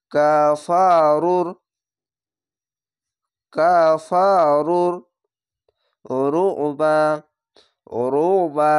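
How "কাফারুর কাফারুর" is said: কাফারুর chanted slow and loud, letters dropped; recited slowly. Shown as a demonstration. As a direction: recite কাফারুর joining the র, skipping silent letters. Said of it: "চারটি অক্ষরই বাদ হয়ে যাবে".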